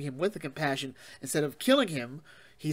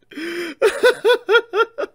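high, loud incredulous laugh; real disbelieving cackle; burst of laughter; 10 dB louder than usual. Real, not acted. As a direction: laugh out at the shocking twist. Reviewer laughs at the twist.

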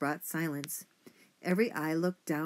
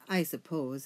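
ordinary delivery, nothing marked

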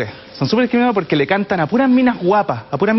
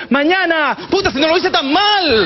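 grito burlón más agudo; público gritando; 9 semitones higher than usual. Retold or imitated. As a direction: grita como el público que se burla. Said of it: "la gente le grita". (grito de burla del público)

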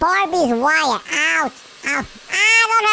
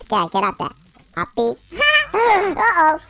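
ordinary talk, nothing marked

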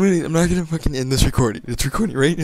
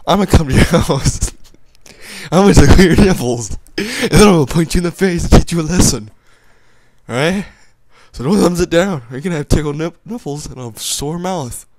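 mock threat; 5 semitones higher, 9 dB louder than usual. Mock threat to thumbs-down viewers.

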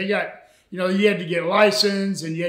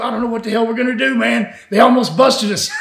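rattled rush; 5 semitones higher, 5 dB louder than usual. Retold or imitated. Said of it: "come back to my house and go".

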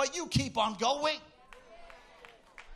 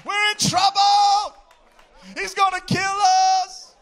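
higher, louder panicked brothers, hammed-up; mock panic; 6 semitones higher, 9 dB louder than usual. Brothers panicking at seeing Joseph in power, hammed-up fear. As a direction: play the brothers losing it in alarm. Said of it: "His brothers were so afraid".

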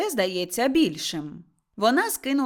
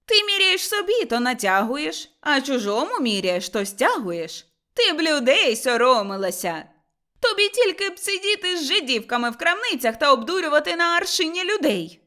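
raised, indignant reproach; scolding; 5 semitones higher, 4 dB louder than usual. Acted. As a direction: shame her for cheating people. Mother-in-law scolds the cheat. (обурено соромить і лає)

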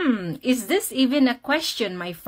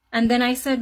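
ordinary delivery

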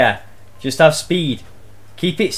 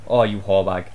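normal talking voice

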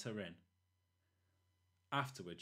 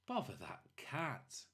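drawn-out, weary grumble; slow grumble; 3 semitones higher, slower than usual. Acted. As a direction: drag it out, fed up. Mr Thomas grumbles, slowly.

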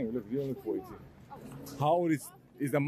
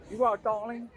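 playful, higher-pitched "darling"; put-on "darling" talk; 7 semitones higher than usual. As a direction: pitch it up, teasing affectation. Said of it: "I talk like that sometimes".

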